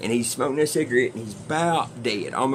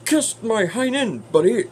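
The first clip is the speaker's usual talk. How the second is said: hammed-up censored movie cussing, pitched way up; TV-edit dub; 10 semitones higher, 4 dB louder than usual. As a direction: put on a high, silly overdubbed TV voice. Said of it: "I love the T B S version".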